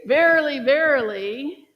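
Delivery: booming biblical verily, verily; proclaiming like Jesus. Acted. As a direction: call it out like a prophet. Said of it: "When Jesus would be speaking".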